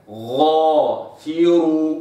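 غافر with a full, heavy غ, very slow; heavy ghain. Shown as a demonstration. Teacher demonstrating the heavy ghain, very slow. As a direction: stretch غافر, ghain thick and emphatic. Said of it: "شفت الغين كده مفخمة".

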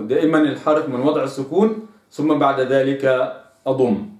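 usual speaking voice

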